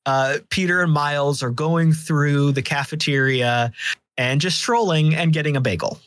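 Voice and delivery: normal talking voice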